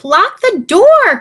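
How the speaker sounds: high, slow, urgent plea; Bo Peep crying out. A narrator's reading. Bo Peep begging Little Bear to lock the door, urgent cry. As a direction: cry it out in alarm. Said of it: "cried Bo Peep".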